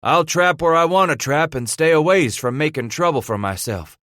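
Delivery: scornful. Acted. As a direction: scoff at the very idea. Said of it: "Silas snorted".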